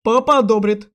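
loud, curt clincher; forceful smug put-down; finishing blow. A narrator's reading. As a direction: deliver the final jab loudly. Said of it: "добил он её".

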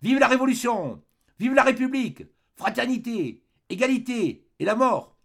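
high, loud defiant shout; defiant cry. A narrator's reading. Old man on the barricade to the soldiers, defiant high shout. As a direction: hurl the slogans at the troops. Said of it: "et cria".

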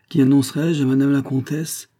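high, loud announcing voice; old concierge. A narrator's reading. Old concierge asking a visitor's name, formal call. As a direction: call it out as a servant at the door. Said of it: "demanda la voix du vieux concierge".

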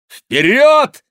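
very high, loud battle cry; shout. A narrator's reading. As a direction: yell it as a charge. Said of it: "крикнул Реми". (громкий боевой клич)

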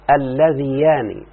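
اللذيان drawn out slowly, every syllable clear; slow stressed form. Shown as a demonstration. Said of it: "أن يقال". (نطق اللذيان ببطء وتأنٍّ)

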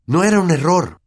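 put-on high, loud boy's retort; boy angrily standing his ground; angry. A narrator's reading. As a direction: contradict your uncle crossly. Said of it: "dijo Harry enfadado".

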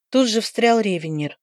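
ordinary delivery

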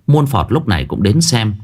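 ordinary delivery

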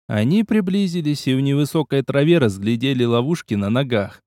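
plain reading voice

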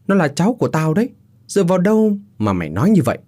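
fast, high-pitched scolding; indignant rebuke. Acted. Father defending his unborn grandchild, indignantly. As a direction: challenge him indignantly.

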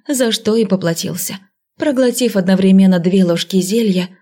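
usual speaking voice

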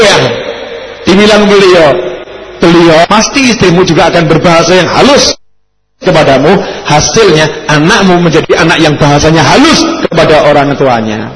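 ordinary delivery

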